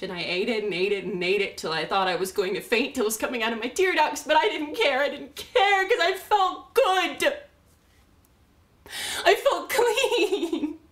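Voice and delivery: building frenzy. Acted. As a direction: rush upward into loud, high ecstasy. Binge confession builds to ecstasy.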